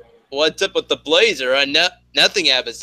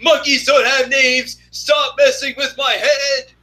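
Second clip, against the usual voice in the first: imitating the professor; 10 semitones higher, 3 dB louder than usual. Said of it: "Professor Fry's all like".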